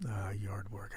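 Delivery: whispered, dry sarcasm; wry whisper. Real, not acted. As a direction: sigh it out wryly. Wry comment on chores.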